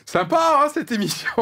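mock cheerful, laughing through it; sarcastic. Mock reaction to doom talk.

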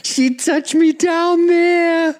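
high, drawn-out Boston squeal; hammed-up Boston accent, very high and slow; bad Boston accent. Giddy film character telling his pal John, squealing Boston accent. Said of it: "he's got a shitty Boston accent now".

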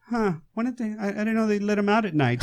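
high, puzzled nurse voice, mock-innocent; a hint of a clueless nurse. Nurse driving the doctor, sees escaped patients, mock puzzled. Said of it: "she's like".

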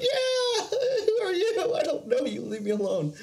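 high laughing panic; high giggly nervous pushback; imitating himself tripping, laughing and freaked; freaking out. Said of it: "I'm kind of freaking out".

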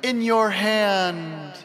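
high, gentle princess voice. Acted. Voicing Zelda's message.

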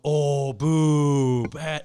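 loud imitated crowd booing; mock crowd boo. Retelling the crowd booing his joke, loud mock boo. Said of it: "The entire crowd boos".

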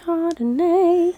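slow, high playful Chardonnay; sing-song echo. Pretend.

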